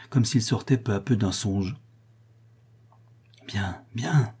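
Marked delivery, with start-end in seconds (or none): 3.5-4.3 hushed aside. A narrator's reading. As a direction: say it under your breath to a confidante. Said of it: "dit tout bas le docteur à Louise".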